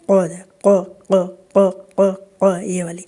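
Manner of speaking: ق repeated low and thick from the throat; deep qaf drill. Shown as a demonstration. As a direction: drill the heavy ق sound. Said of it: "یہ والی آواز". (ق کی موٹی آواز بار بار)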